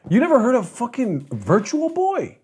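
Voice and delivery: high-pitched mock disbelief; incredulous cousin. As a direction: imitate a cousin who can't believe it. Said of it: "and he starts going".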